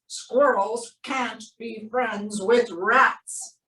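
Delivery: usual speaking voice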